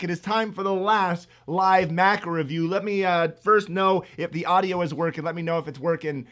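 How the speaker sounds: normal talking voice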